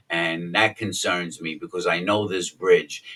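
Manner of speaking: usual speaking voice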